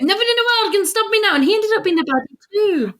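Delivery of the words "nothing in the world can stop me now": high, fast mock villain line; villain quote. Podcaster quoting a baddie for laughs, high-pitched hammy impression. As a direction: race through it in a high put-on voice. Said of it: "It reminded me of".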